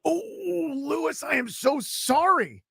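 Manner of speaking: high, slow, hammed-up sympathy; mock sorrow. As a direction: pretend to be heartbroken for him. Host mock-consoles the caller.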